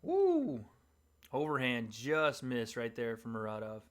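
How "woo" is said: excited whoop. Real, not acted. Reacting to a big punch.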